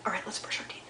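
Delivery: soft hushed whisper. Real, not acted. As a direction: whisper at bedtime. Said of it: "bedtime routine with kids".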